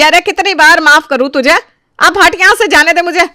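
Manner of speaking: high, loud angry outburst; furious. Acted. Mother-in-law orders daughter-in-law out of her way, furious. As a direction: lash out at full voice. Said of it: "आज माया देवी बहुत गुस्से में थी".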